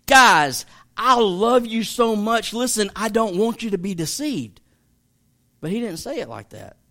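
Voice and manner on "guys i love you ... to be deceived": louder and higher, calling out to friends to grab their attention; urgent attention-grabbing call. Shown as a demonstration. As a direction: open loud, then press the warning. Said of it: "I would say".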